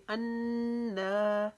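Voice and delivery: अन्ना with the doubled न held long through the nose; ghunna on nun. Shown as a demonstration. Teacher shows students the nasal ghunna on अन्ना. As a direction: hum the न of अन्ना for one alif. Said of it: "एक अलिफ के जितना हम यहाँ पर गुन्ना करेंगे".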